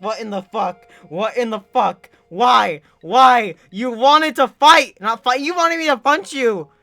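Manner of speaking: loud, high indignant retelling; outraged shouting. Retold or imitated. Kid given lunch detention protests, outraged shouting. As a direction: build into an exasperated yell of protest.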